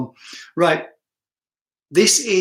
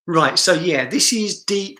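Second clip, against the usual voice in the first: ordinary talk, nothing marked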